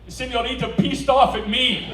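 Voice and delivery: hammed-up tourist English; mock broken English. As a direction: talk down to the clerk.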